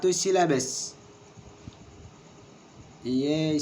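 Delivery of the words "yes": ordinary talk, nothing marked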